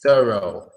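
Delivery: "thorough" said slowly as thuh-roh; pronouncing thorough. Shown as a demonstration. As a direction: model the word "thorough" clearly. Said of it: "Try another one".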